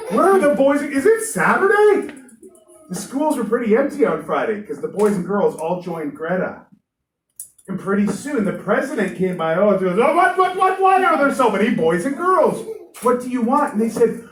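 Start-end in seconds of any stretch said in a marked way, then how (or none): 0.1-2.1 much higher, louder baffled questions; puzzled teacher. Acted. Teacher finds empty classroom. 10.0-13.7 much higher, louder flustered questions; startled president. Acted. President sees the crowd.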